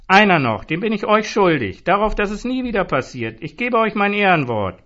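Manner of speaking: put-on high, loud drunken vow; loud boozy promise; drunken pledge. A narrator's reading. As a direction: swear it loudly, a bit sloppy. Drunk Zoran insisting.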